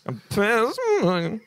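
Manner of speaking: high, slow incredulous take on I'm Piers Morgan; a disbelieving, hammed-up self-realisation; rising disbelief. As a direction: sound amazed to be him. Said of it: "look at himself in the mirror every morning and say".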